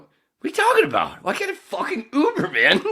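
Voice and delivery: imitating her own irritated outburst at hospital staff; pissy outburst. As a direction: replay the fed-up rant at full volume. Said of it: "starting to get a little pissy going".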